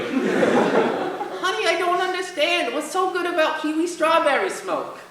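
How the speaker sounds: high-pitched worried mom. Acted. Mom questioning her teen about vaping, in a high mom voice. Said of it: "mom voice?".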